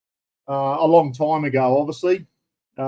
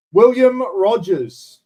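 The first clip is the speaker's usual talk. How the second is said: "William Rogers" called out slowly and louder; slow loud name call; 5 semitones higher, 6 dB louder, much slower than usual. Real, not acted. Greeting a new viewer on the live stream, slow and loud.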